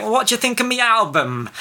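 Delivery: high nasal Northern English character voice; Frank Sidebottom. Retold or imitated. Podcaster quoting a comic character to co-host, high nasal impression. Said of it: "he talks in a very high nasal voice".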